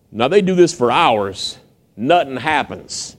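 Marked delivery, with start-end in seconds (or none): none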